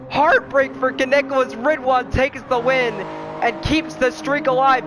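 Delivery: high, loud, fast finish call; excited race call. Acted. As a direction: scream the finish like a commentator.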